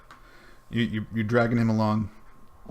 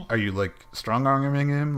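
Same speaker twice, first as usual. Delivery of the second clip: normal talking voice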